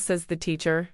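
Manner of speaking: usual speaking voice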